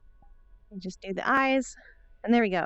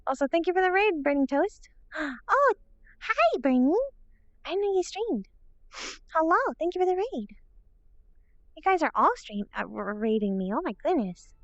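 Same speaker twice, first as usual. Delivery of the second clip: high, loud excited thanks; cute anime streamer; 9 semitones higher, 4 dB louder than usual. Real, not acted. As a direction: gush over the raiders.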